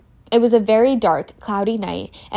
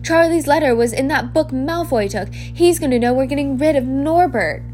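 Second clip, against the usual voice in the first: put-on higher, louder worried boy; panicked Ron; 5 semitones higher, 4 dB louder than usual. Ron panicking to his friends in the hospital wing, higher and louder. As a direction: blurt it out in a panic.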